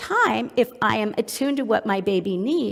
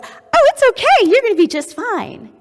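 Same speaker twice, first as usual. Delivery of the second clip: very high, loud, over-bright reassurance; falsely cheerful; 13 semitones higher, 10 dB louder than usual. Acted. Mom trying to cheer distressed baby, overly upbeat. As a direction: gush it far too brightly at a distressed baby. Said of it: "give a positive facial expression".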